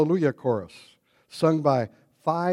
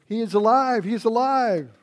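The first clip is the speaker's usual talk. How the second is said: higher, louder happy cry of alive; excited shout that the Lord lives; joyful cries; 8 semitones higher, 4 dB louder than usual. Pretend. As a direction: call it out like jubilant disciples. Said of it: "no cries of".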